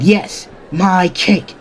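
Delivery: high, drawn-out insistence; indignant demand. Acted. Bakugo confronts Izuku over the cake, indignant demand. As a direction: demand it slowly, word by word.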